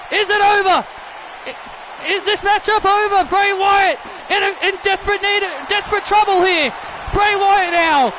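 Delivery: excited wrestling call, pitch climbing as the finish nears; urgent play-by-play. Acted. Commentator sensing a new champion, urgent play-by-play. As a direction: build to a high, shouted near-fall call. Calls a near finish live.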